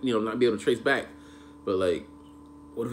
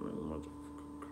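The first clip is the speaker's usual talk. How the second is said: muttered under the breath; a quiet, disgusted curse; whispered curse; 8 semitones lower, 18 dB quieter than usual. Retold or imitated. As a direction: whisper it so nobody hears. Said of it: "I'm like".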